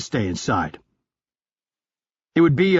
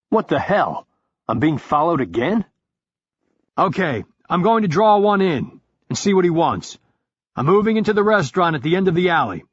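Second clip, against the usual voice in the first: lightly raised character voice; 5 semitones higher than usual. Acted. Pike reacts and plans.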